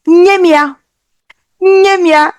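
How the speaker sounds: high, clear model word for the class; demo to repeat. Shown as a demonstration. Scene: teacher modelling a word for kids to repeat, high and clear. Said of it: "Can you say that?".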